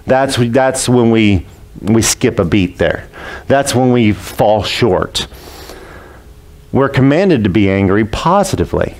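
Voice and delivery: ordinary delivery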